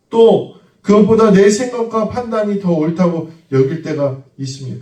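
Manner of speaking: fervent sermon delivery; full-force preaching. Acted. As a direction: preach it out to the congregation.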